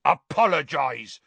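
a snarled command. Acted. Hagrid to Karkaroff, snarling a demand. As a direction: bite it out in threat. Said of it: "Hagrid snarled".

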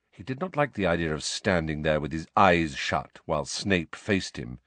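ordinary delivery

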